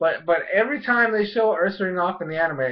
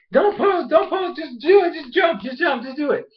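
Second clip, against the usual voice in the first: high, pleading coaching shouts; frantic urging; 8 semitones higher than usual. Retold or imitated. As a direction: urge the fighter on in a high, pressing voice. Bonnie urging Hawlucha, acted.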